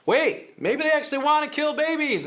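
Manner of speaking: high-pitched mock alarm; alarmed realisation. Voicing what people would think.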